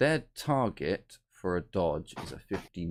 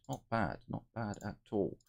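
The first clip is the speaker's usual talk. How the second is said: ordinary delivery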